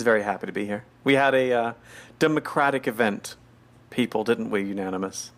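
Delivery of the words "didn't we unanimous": normal talking voice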